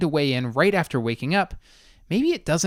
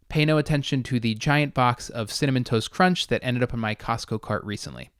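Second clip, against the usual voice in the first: ordinary talk, nothing marked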